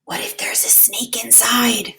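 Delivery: breathy whispered worry, barely voiced; a child's anxious whisper in the dark; worried whisper. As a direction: whisper it like a scared secret. Wemberly's fearful thought.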